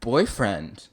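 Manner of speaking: loud, startled echo of the word; taken aback. Real, not acted. Reader balking at the ogre boyfriend, taken aback. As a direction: blurt it back in disbelief.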